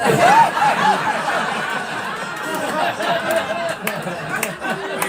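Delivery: long high laughing fit. Real, not acted. Comic in front of the audience, still laughing high and long.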